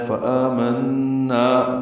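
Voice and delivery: فآمنا chanted slowly with the آ stretched long; فآمنا sung with a long vowel; long madd on aa. Shown as a demonstration. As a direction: draw out the آ in فآمنا. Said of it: "فآمنا میں آ میں مد بدل ہے".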